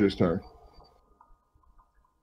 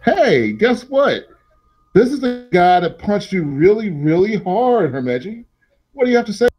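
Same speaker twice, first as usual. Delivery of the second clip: taunting character; 9 semitones higher than usual. Acted. Game master playing a character confronting the player, taunting. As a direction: pitch it way up and needle them.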